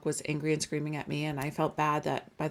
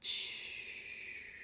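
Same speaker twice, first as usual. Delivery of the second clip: long, soft exhaled "shh"; calming-down hiss; 14 dB quieter than usual. Retold or imitated. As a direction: imitate tension draining away in one breath. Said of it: "it completely calmed down".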